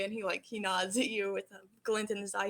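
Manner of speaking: normal talking voice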